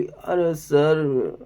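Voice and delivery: put-on slow, drawn-out little-girl whine; mock childish Tania. Vlogger mimics Tania's childlike way of talking, slow whine. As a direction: stretch it out like a small child complaining. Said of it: "वो ऐसे बोलती है भाई".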